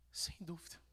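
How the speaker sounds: whispered sem dúvida, barely voiced; hushed sem dúvida. Shown as a demonstration. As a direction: breathe sem dúvida softly. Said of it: "whispered take".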